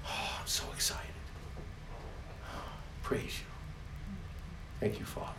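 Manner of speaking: hushed, breathy worship; whispered praise. Real, not acted. Preacher praising the Father, hushed whisper. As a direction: whisper thanks in awe.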